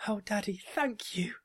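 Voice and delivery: very high, loud thanks; grateful cry. A narrator's reading. Marzipan hugging her dad in thanks, grateful cry. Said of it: "Marzipan cried and hugged him".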